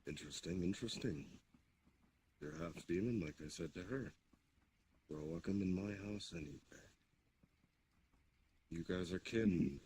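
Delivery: ordinary talk, nothing marked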